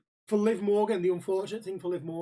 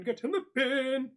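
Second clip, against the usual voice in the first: high, stretched catchphrase finish; hammed-up closing holler; drawn-out yell; 7 semitones higher, slower than usual. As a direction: swing back up for the last one. Catchphrase builds back to a yell.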